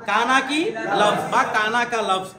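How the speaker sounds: ordinary talk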